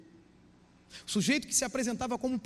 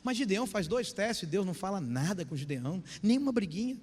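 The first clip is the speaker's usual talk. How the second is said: usual speaking voice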